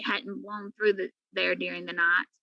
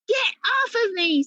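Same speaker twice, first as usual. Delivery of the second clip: loud, high angry yell; cat yells at the pig on top of her; 9 semitones higher, 6 dB louder than usual. A narrator's reading. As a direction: shove her off with a cry. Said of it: "Sophie yelled".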